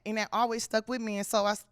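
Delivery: ordinary talk, nothing marked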